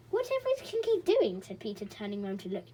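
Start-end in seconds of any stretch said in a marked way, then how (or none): 0.1-1.4 high, loud puzzled question; young boy's surprised question. A narrator's reading. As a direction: wonder aloud, surprised. Boy character asks.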